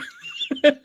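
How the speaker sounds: high giggle at own joke; corny dad laugh. Real, not acted. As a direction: chuckle at your own pun. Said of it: "laughing at my own joke".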